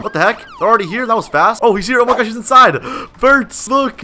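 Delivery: high-pitched, breaking-news astonishment; excited surprise. Real, not acted. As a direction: react amazed, pitch climbing as it goes. Police suddenly arrive to rescue.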